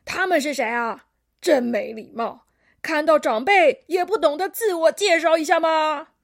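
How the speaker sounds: loud, indignant grandpa; crotchety elder telling off kids; scolding old koala. A narrator's reading. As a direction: demand manners loudly, rising to outrage.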